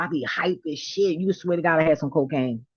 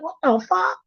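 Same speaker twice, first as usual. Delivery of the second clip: retold shocked disbelief; 13 semitones higher than usual. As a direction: gasp at how young she still looks. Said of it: "I was like".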